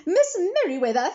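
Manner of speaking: high, loud urgent call. A narrator's reading. McBee calls for Miss Merriweather down the hall, loud. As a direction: call her name down the hall. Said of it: "he called as he walked".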